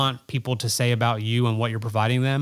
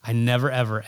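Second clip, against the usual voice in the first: usual speaking voice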